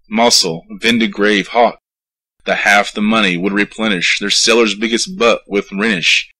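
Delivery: ordinary delivery, nothing marked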